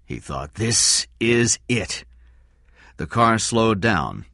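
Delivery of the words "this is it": slow, drawn-out repeat; tense. Acted. Cy waits for the car, slow and tense. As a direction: let it land slowly.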